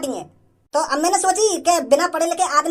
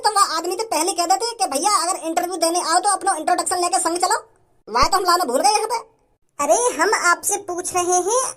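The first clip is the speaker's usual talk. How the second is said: ordinary talk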